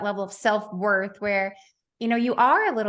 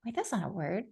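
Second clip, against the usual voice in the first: inner thought; 2 semitones lower, 9 dB quieter than usual. Retold or imitated. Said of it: "thinking, like".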